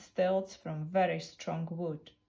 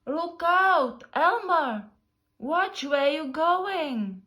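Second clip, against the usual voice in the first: much higher, louder, slow warning call; giraffe calling out; 9 semitones higher, 9 dB louder, slower than usual. Acted. Tall giraffe warns Elmer who nearly walks into him, loud slow call. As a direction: shout down a warning from high up.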